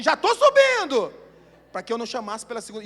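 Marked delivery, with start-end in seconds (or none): 0.0-1.1 much higher, louder, slow yelled reply with a laugh; hurried shout. Retold or imitated. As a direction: call down that you're coming, laughing. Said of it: "ela falou assim".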